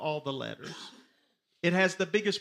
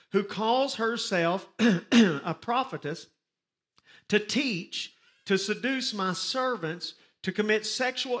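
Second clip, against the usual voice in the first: scripture reading; 3 semitones higher, 3 dB louder than usual. Acted. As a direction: proclaim the accusation line by line. Reads scripture aloud to congregation.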